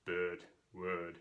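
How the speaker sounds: Austrian-accented "bird, word" with rounded vowels; Arnold Schwarzenegger. Shown as a demonstration. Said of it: "imagine Arnold Schwarzenegger saying".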